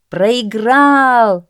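high, loud, drawn-out cry; crowd uproar. A narrator's reading. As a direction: roar the verdict like a crowd. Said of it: "зашумела толпа".

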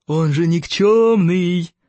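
slow jeer with a stretched last word; gloating holler. A narrator's reading. Rival tells everyone the boy is useless, jubilant holler. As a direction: crow over him, drawing the insult out. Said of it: "ликующе завопил Сморкало".

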